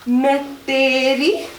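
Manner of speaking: slow, drawn-out lyric cue; lingering "Main teri"; slowly. Shown as a demonstration. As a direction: stretch the words to guide a slow move. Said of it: "Slowly in".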